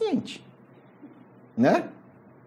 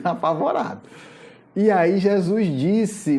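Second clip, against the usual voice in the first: ordinary talk, nothing marked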